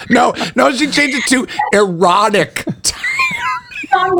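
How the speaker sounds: high breathless laugh-whisper through the words; can barely get it out for laughing. Real, not acted. Host laughing while telling the talk-show host about the erotic tiger nickname.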